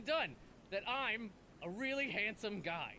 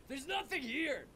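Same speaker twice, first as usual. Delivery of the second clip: ordinary talk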